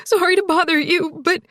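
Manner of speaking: high, tearful apology; choked. A narrator's reading. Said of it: "Zoe choked".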